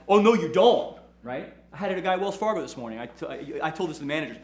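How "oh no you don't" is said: loud, higher snap of defiance; defiant pushback. Retold or imitated. Facing down a bully, a loud defiant oh no. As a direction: stand your ground against someone coming at you. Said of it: "I'm like".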